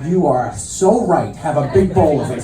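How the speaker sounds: slow, dry agreement; deadpan sarcasm. Acted. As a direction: drag it out, totally unimpressed. Dry comeback to his daughter.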